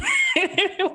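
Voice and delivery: squealing laugh; high burst of laughter. Real, not acted. Laughs at own accent bit.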